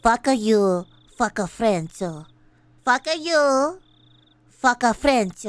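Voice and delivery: low, loud fake French accent; mock French accent. Streamer mocks French speakers in a loud fake French accent. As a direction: growl it like a cartoon Frenchman. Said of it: "mock French accent on fuck a French".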